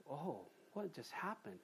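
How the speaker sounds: soft, slow and baffled; a hushed puzzled murmur; bewildered. Retold or imitated. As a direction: wonder aloud, lost and quiet. Bewildered people after the rapture.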